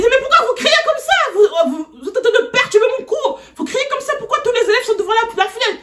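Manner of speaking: hammed-up shrieking teacher voice; imitating angry art teacher. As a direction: squeal like an outraged teacher interrupted mid-class. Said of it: "Il dit".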